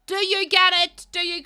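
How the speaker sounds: loud, high confronting demand; indignant challenge. Acted. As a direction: throw it back at him, outraged. Angry challenge to helper's platitudes.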